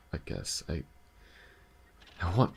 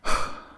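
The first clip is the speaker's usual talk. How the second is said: long weary sigh out loud; heavy sigh; 7 dB louder than usual. Acted. Sighing to partner before making a hard offer. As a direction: let out a heavy tired sigh.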